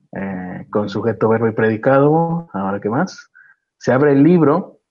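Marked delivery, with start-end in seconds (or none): none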